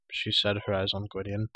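plain reading voice

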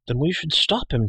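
high, eager resolve; impetuous young declaration; bold declaration. A narrator's reading. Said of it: "Taran declared".

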